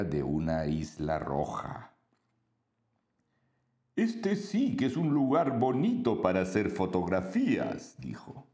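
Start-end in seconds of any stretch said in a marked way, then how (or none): 3.9-7.9 friendly monster voice. Acted. Monstruo habla en isla roja.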